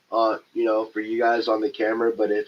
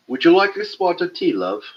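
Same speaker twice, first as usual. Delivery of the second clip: put-on British accent; 7 semitones higher, 5 dB louder than usual. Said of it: "a spot of tea is a cup of tea".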